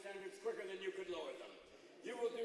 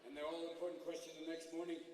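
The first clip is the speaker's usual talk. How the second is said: usual speaking voice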